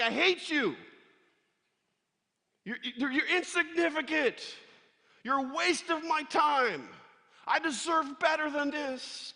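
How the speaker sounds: high, loud, spiteful rant; angry attitude. Pretend. Pastor voices David ranting at his sheep, loud and angry. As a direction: shout your contempt at the sheep, slowing at the end. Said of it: "He could have had an attitude like".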